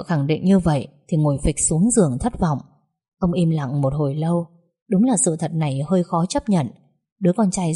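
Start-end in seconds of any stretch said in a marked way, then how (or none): none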